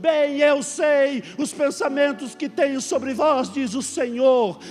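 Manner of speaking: slow preaching proclamation building to a shout; prophetic proclamation. Acted. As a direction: cry it out like a prophet from the heights. Quotes prophet crying out.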